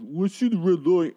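high mocking voice in a put-on tone; mock quote. Mocking the show's cheesy line.

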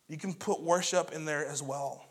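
normal talking voice

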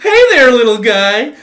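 high, loud, slow clown taunt; silly clown. Acted. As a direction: tease like a goofy clown. Clown teasing the lion.

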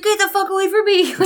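high-pitched imitation of an angry scream; hammed-up furious outburst; mock scream. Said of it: "he's screaming at her".

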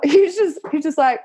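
ordinary delivery

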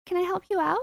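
put-on high-pitched baby talk; sing-song pet voice; cooing to a kitten. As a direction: coax a lost kitten, high and sweet.

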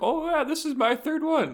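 high, excited lady voice; chatty concertgoer. Retold or imitated. Woman telling seatmates she's a regular, high imitated voice.

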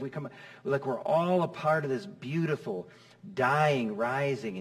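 ordinary delivery

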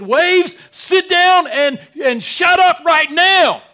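loud, slow rebuke to the waves; commanding shout. Retold or imitated. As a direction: bark the storm into silence. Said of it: "he probably just said".

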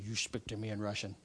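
low, quiet stern Russian guard voice; a hint of a stern guard brushing him off; gruff guard. Russian guard answers the pastor.

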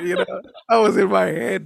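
ordinary delivery

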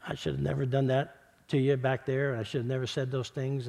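hushed and humble, asking pardon; sincere apology. Retold or imitated.

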